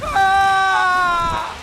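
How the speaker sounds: sudden high cry of pain; sharp high yelp from torture. Acted. Interrogated acolyte shocked by force.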